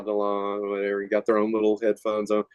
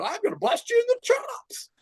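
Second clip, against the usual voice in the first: very high, squeaky cartoon-style "chops" threat. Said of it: "like a cartoon saying".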